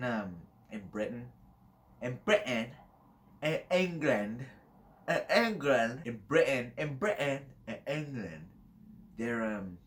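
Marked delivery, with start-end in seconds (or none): 2.0-8.5 exaggerated, higher-pitched British accent; put-on British accent. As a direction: play up the British accent on each word. Playing with British accent on words.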